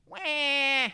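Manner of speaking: put-on loud, high baby cry; hammed-up crybaby noise; mock baby wail. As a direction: wail like a whining infant. Mocking the disciples as babies.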